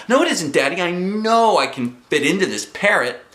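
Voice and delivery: high, loud insistence; protesting. A narrator's reading. Girl contradicts Daddy about the swim ring, protesting.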